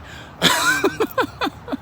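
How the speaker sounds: high wheezing laugh; long breathy burst of laughter; laughing to tears. Real, not acted. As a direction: crack up, helplessly amused. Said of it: "I'm crying over here".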